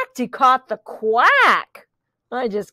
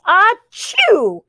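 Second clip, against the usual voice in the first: a loud high achoo; pig sneeze; 7 semitones higher, 4 dB louder than usual. Acted. Reading aloud, the pig sneezes. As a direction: sneeze loudly.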